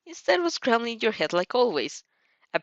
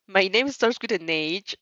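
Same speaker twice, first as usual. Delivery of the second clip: ordinary delivery, nothing marked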